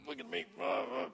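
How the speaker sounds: showing off, hammed-up boast; boastful mock. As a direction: strut it, hammed-up and high-pitched. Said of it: "we need to be boastful and say".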